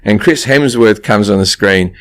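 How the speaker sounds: ordinary talk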